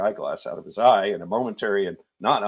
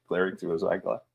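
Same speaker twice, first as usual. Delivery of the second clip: usual speaking voice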